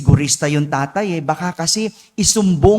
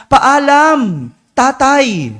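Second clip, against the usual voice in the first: shouted farewell; 6 semitones higher, 5 dB louder, much slower than usual. Acted. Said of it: "biglang sumigaw".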